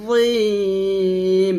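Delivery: জিম with a heavy, long z; thick z drawn out. Shown as a demonstration. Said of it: "জ উচ্চারণ করতে হবে মোটা করে".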